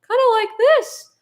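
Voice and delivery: a silly high voice to show what Bob might sound like; very high-pitched voice. Shown as a demonstration. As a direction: pitch it way up into a tiny squeak. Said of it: "Bob could be talking in a very high-pitched voice".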